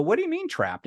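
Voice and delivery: high-pitched jump on the question; incredulous challenge. Retold or imitated. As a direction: push back at the brother, baffled. Challenges brother's word, acted.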